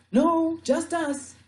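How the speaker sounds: high, loud, slow call back; drawn-out high shout. Acted. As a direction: call the answer to a far boat. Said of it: "I called out".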